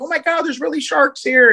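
high-pitched mock panic; imitating freaked-out kids. Storyteller imitates kids spotting sharks off the pier, freaking out. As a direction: squeal it like startled kids, without shouting. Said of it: "they're freaking out".

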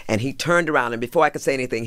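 ordinary delivery

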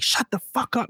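sudden high mock yell; a yell thrown at you, imitated; retold yell. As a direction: snap it out like someone yelling in your face. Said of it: "If someone yells at you in your face".

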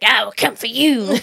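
put-on drawled Southern accent threat. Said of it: "talking shit about the southern accent".

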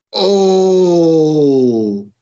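crowd ooh. Retold or imitated. Crowd pop imitated.

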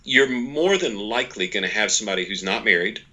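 usual speaking voice

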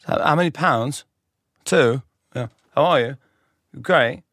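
put-on British accent, questioning. Tourist passing as British in small talk, questioning British accent. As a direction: pretend British, lilting like questions. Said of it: "in kind of a form of a question".

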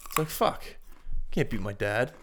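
usual speaking voice

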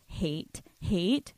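usual speaking voice

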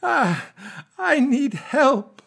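very high, slow, breathy-sounding plea; a ghostly siren begging for help; haunting beautiful voice. Acted. Guest pleads with Stephen for help, haunting high slow voice. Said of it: "says in the most haunting, beautiful voice".

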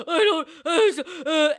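loud panicked squeal; panicked yelp. Retold or imitated. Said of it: "I somehow magically went".

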